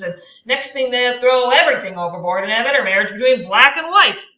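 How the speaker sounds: rant, climbing to a shout; Tom's rant. Acted.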